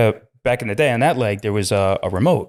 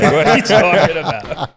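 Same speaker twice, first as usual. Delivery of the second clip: laughing; 7 semitones higher, 4 dB louder than usual. Retold or imitated. Mocking the kid's claim.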